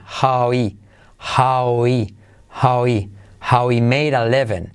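"how he" repeated with the H of he dropped, low and steady; dropped H drill. Shown as a demonstration. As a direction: drill it, letting the H vanish. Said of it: "esa H de he se suele omitir". ("how he" sin H, repetido)

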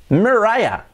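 "Mariah" as mer-RYE-a, pitched high; English R, RYE stressed in "Mariah"; English pronunciation. Shown as a demonstration. Teacher demonstrating the first name, English pronunciation. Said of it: "se pronunciaría así".

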